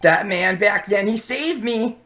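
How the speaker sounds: high-pitched desperate self-justifying; broken villain pleading. Acted. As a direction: plead your case as you fall apart. Villain pleads his case.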